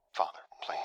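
urgent pleading whisper; soft whispered plea. A narrator's reading. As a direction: breathe it to calm his father. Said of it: "Those two soft words".

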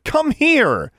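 high, loud urgent command; urgent shout. A narrator's reading. Girl calling back her raven, shouting. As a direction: yell for the bird to return. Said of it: "Alexandra shouted".

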